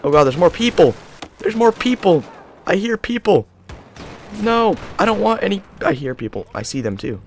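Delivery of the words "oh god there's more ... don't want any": fear as enemies close in; frightened rising cries; scared alarm. Real, not acted. Enemies approaching.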